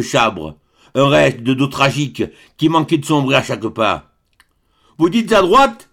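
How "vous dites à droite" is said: high, louder, begging voice of a man lost in the water; pleading husband. A narrator's reading. Stranded husband begs the way, pleading high. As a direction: implore her, higher and a bit louder. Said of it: "implora le mari".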